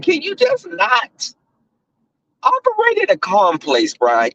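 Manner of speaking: high exasperated whine at Brian; exasperated plea. Real, not acted. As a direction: beg him to just calm down, voice climbing way up.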